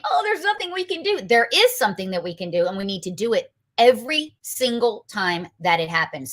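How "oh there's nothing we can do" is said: high, mocking whiny voice; mock whining. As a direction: parody the helpless complainers. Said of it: "We've been sitting here whining, saying".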